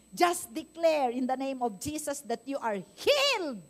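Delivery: slow, loud preaching declaration. Acted. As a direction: proclaim scripture to the congregation. (mabagal at malakas na pangangaral)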